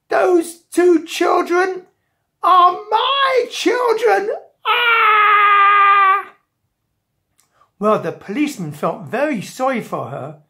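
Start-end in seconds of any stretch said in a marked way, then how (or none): 0.1-4.3 very high, loud, slow wail; distraught mother. Acted. Mother telling policeman whose children are stuck, wailing. 4.6-6.3 long, high, loud scream; mother's wail. Acted. Mother distraught over her trapped children, wailing.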